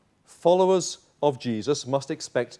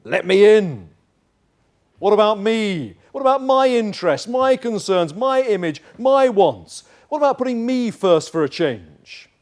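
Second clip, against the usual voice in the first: self as a pushy voice, higher and louder, demanding; whining self; 6 semitones higher, 7 dB louder than usual. Acted. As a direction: plead and push to be put first. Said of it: "self comes knocking".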